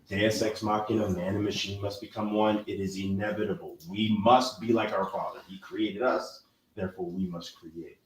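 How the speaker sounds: usual speaking voice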